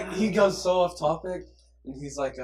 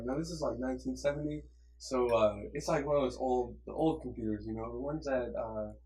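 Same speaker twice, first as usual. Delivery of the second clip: low, soft, rambling teacher imitation; soothing teacher; 4 semitones lower, 7 dB quieter than usual. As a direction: drift along gently like a monotone teacher. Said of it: "he has the most soothing voice".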